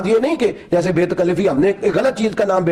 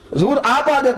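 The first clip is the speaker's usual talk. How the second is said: pleading servant; 7 semitones higher than usual. Acted.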